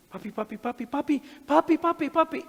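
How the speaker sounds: frightened cries rising to a high, loud scream; a terrified child calling out in the night, building to a shriek; child screaming for dad. Retold or imitated. Daughter wakes from a nightmare screaming for her father, high and panicked. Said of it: "empieza a pegar gritos".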